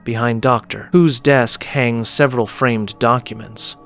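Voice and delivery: ordinary delivery, nothing marked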